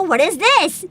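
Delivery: ordinary talk, nothing marked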